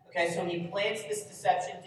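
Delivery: ordinary talk, nothing marked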